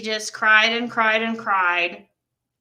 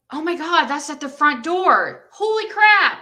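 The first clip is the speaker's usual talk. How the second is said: rising to a high, louder holy crap; retold astonishment; 5 semitones higher, 4 dB louder than usual. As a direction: relive the shock with a laugh. Retells hearing puppy at front.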